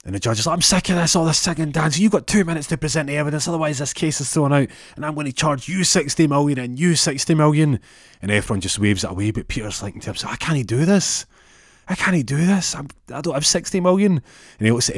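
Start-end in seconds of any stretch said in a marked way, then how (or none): 0.6-7.8 raised, high-pitched fed-up outburst; exasperated judge. Acted. Acted exasperated judge in a story. 10.3-14.2 high, distressed protest; panicked inner voice. Acted. Acted panicked thought of Peter.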